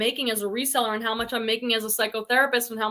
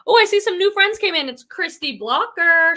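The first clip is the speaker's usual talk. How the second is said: excited greeting; 6 semitones higher, 7 dB louder than usual. Real, not acted. Greeting new viewers on live.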